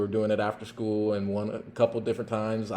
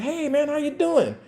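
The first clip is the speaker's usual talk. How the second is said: friendly greeting. Retold or imitated. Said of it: "I'm like".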